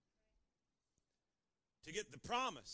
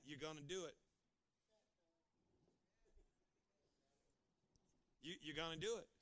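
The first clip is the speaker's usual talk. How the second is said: firm parent; 4 semitones lower, 3 dB quieter than usual. Acted. Parent answering child.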